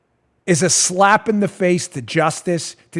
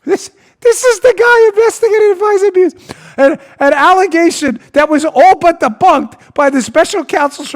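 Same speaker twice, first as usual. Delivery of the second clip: much higher and louder, aghast; incredulous outburst; 9 semitones higher, 5 dB louder than usual. Real, not acted. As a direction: cry out in disbelief. Outraged disbelief at appointment.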